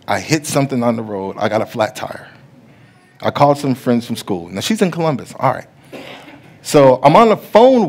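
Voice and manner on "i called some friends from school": usual speaking voice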